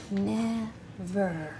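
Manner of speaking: quiet, drawn-out "Never"; soft sounding-out. Shown as a demonstration. Teacher prompts children writing the ending, softly sounding it out. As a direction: say it low and slowly.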